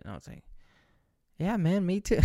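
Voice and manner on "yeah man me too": mock reply. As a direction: toss it off as a fake agreement. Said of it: "No, I didn't say that".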